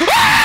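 sudden very high, loud scream; startled scream. Real, not acted. Player screaming at a jump scare.

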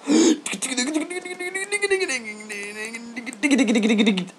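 high squeaky chattering noise; squeaky gibberish trill. Pretend. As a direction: babble like a squeaking creature. Long squeaky nonsense noise.

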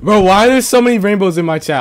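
high, loud complaint; exasperated yell. Real, not acted. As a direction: shout at the chat, totally fed up. Fed up with chat spam.

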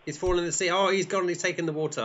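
high-pitched running commentary on a mishap; excited race commentary. Pretend. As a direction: exclaim as a rival tumbles. Rival runner falls in the sea.

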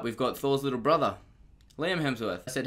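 usual speaking voice

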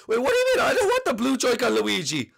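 high-pitched, hammed-up outrage; indignant protest. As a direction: burst out in offended disbelief. Mario rejects the offered part.

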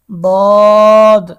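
দদ held loud on a long rounded o; three-alif stretch. Shown as a demonstration. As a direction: stretch the vowel of দদ for three alifs, loud and even. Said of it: "তিন আলিফ টান". (দদ জোরে তিন আলিফ টেনে)

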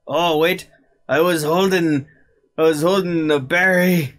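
excited realization. Real, not acted. Sudden realization in battle.

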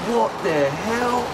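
loud, high, drawn-out mock bellow; a playful imitation of an outraged movie tough guy; Samuel Jackson shout. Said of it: "You can just hear Samuel Jackson".

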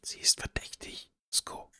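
hushed whisper into the ear; whispering a secret. Acted. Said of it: "Flüstert dir ins Ohr".

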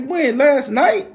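very high, very loud question; acted shock. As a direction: blurt it out in disbelief. Shocked at news of death.

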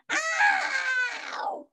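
very high, drawn-out "ow"; mock pained yell. A narrator's reading. Boy bites apple with loose tooth, mock pained yell. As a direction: wail it high in pretend pain. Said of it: "and yelled".

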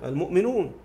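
usual speaking voice

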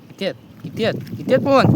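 from a plain question up to a high, loud excited cry; building excitement. Real, not acted. Vlogger counting crabs in the net, getting excited.

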